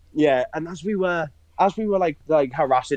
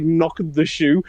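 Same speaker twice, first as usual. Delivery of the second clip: usual speaking voice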